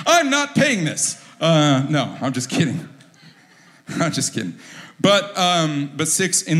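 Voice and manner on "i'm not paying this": slow, much higher put-on voice balking at the bill; hammed-up indignant customer refusing to pay; mock refusal. Shopper sees 666 on the receipt, hammed-up refusal.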